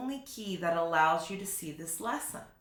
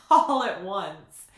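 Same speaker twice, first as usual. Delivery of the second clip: laughing; 8 dB louder, slower than usual. Real, not acted. Laughs then speaks.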